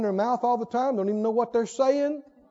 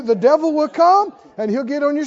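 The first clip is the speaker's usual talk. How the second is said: normal talking voice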